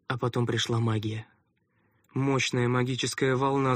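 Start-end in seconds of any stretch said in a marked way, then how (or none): none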